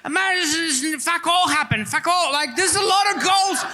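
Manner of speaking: put-on a mild Hughesy-style Australian accent. Doing an Aussie accent for his bored mates, light Australian twang. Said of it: "[name]'s Australian accent impersonation".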